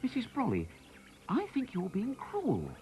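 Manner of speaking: put-on high, slow boy's plea; a small boy gently protesting; high child's voice, reproachful; young Harry. Harry voiced pleading with Mrs Brolly.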